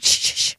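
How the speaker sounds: quick unvoiced shushes; retold "shh, shh, shh"; shushing. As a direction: hush an insistent inner voice. Shushing her inner voice.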